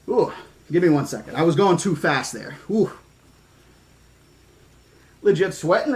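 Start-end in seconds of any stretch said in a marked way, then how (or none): none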